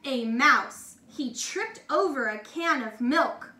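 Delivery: ordinary delivery, nothing marked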